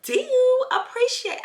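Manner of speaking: high, put-on posh accent. Replying to the comment in her switch-up accent. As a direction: lift the voice into a fancy put-on accent. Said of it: "my little accent that I can do".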